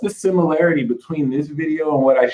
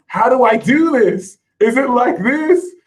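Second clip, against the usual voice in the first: high-pitched imitation of puzzled followers; wondering viewers; 10 semitones higher than usual. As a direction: voice their questions in a raised pitch. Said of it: "a lot of you have been wondering".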